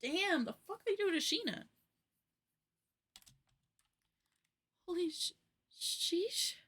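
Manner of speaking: real stunned gamer reaction; shocked reaction. Real, not acted. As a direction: react to the jump scare.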